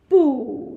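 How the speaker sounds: 不 hit loud, pitch dropping sharply; strong falling tone. Shown as a demonstration. Teacher shows the very strong tone, 不 loud and falling. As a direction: punch 不 with a falling tone. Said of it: "you say like this, very strong".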